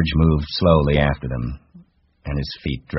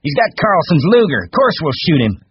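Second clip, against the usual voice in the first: high, loud insistence; outraged cry; 13 semitones higher, 6 dB louder than usual. A narrator's reading. Curly rejects George's plea, outraged. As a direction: insist furiously at the top of the voice. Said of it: "Curly cried".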